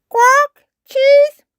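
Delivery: very high, very loud squawk; parrot mimicry. A narrator's reading. Polly copies Grampy Rabbit's order, loud parrot squawk. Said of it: "Polly Parrot copied".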